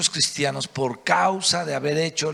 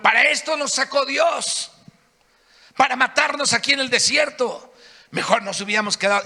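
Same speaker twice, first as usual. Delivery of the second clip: higher, whining protest of the crowd; mock grumbling crowd; bitter complaint; 7 semitones higher than usual. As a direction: complain bitterly that God brought them here. People complaining against God.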